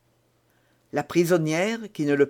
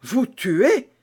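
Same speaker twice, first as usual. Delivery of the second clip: higher, louder gasp of terror; horrified; 7 semitones higher, 4 dB louder than usual. A narrator's reading. Felton cries out in terror at Milady. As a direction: recoil in horror. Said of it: "s'écria Felton avec terreur".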